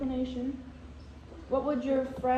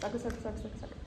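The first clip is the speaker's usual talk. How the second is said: said fast; 4 semitones lower, 11 dB quieter, much faster than usual. Shown as a demonstration. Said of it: "Say sucker three times fast".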